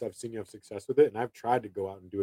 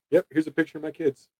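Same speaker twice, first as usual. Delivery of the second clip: breezy demo; 7 semitones higher than usual. Shown as a demonstration. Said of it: "I just do it like that".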